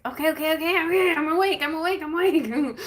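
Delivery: jolted awake, hurrying to reassure; startled waking. Retold or imitated. Jolted awake by the archangel, high flustered protest. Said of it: "I'm like".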